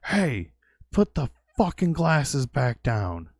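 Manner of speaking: high, angry yell at a thief; retold yell. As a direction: snap at the guy to drop the glasses. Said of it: "I yelled at the guy".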